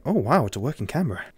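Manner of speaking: ordinary talk, nothing marked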